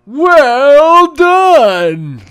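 loud, high announcer voice; booming announcer shout. Pretend. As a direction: belt it like a game announcer. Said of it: "Say it again".

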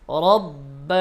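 রব্ব with a full, thick র; heavy ra. Shown as a demonstration. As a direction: round the র in রব্ব. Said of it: "রর উচ্চারণ মোটা হবে". (মোটা র দিয়ে রব্ব)

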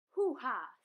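loud scornful laugh; mocking laugh. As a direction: laugh in her face. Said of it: "laughed the wolf".

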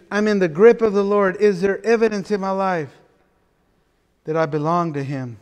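ordinary delivery

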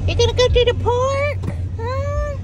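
high eager question to the dog; high pet-talk tease. Real, not acted. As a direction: get the dog excited, way up high.

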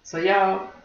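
ordinary delivery

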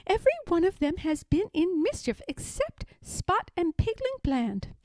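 a flustered mother pig listing her troubles; Aunt Pettytoes. Acted.

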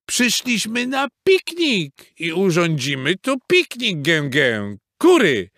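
high, honking bossy goose; bossy goose command. A narrator's reading. Goose ordering hens to spread the blanket, bossy. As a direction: order them about in a high voice. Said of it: "poleciła".

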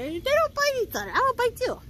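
very high pet-talk scolding; playful scolding. Real, not acted. Playful scolding of pet, repeated higher.

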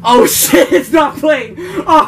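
loud, high laughing panic; shout. Real, not acted. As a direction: yell out in laughing alarm. Music fails, laughing panic.

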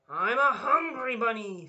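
loud, proud declaration; fierce bunny. Acted. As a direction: bellow it at the bear. Dot faces the bear.

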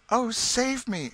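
high, drawn-out damsel's plea; desperate cry. A narrator's reading. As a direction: cry out for rescue, slow and high. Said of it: "she cried".

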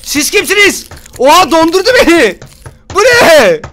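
very high, loud yells of panic; panicked screaming. Real, not acted. Gamer frozen by unknown characters, panicked screaming. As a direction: scream at an attacker in shock. Attacked by new game characters.

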